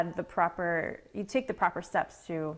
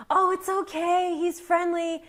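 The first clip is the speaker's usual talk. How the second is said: high, sing-out call of a dog owner; carefree dog owner; 9 semitones higher than usual. Retold or imitated. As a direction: imitate an owner calling out reassurance, pitched way up. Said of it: "And I yell back".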